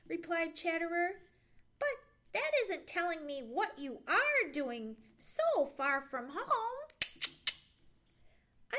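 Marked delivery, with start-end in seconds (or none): 1.8-6.9 higher, louder squirrel voice; insistent squirrel. A narrator's reading. Chatterer questioning Johnny about home, insistent. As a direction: press for an answer, nosy and insistent.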